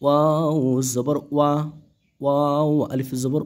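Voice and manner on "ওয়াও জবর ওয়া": ordinary delivery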